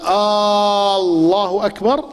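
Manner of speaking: آه الله أكبر very slow, long rising آه; slow rising question tune on آه الله; drawn questioning alif. Shown as a demonstration. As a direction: stretch the آه into a question. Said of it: "لا تصح".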